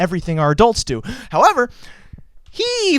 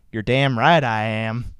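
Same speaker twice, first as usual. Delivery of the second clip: a mild mock Southern growl, lower; imitating Mike; 10 semitones lower than usual. Host mimics his co-host Mike answering him, lightly voiced. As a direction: do Mike's voice, lightly. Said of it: "I gotta do it in his voice".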